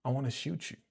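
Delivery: low, soft come-on voice; low, soft seductive pickup line; smooth-talking man. Retold or imitated. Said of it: "he's like".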